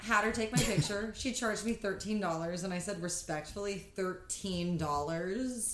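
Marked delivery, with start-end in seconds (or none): 3.0-5.7 slow, incredulous drag; sassy disbelief. Retold or imitated.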